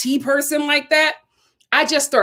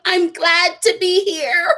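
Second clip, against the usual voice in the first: a touch of a faked sob, slow and higher; mock tearful; 5 semitones higher than usual. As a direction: pretend to hold back tears politely. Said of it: "I was like".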